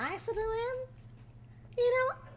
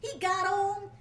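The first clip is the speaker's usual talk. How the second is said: ordinary delivery, nothing marked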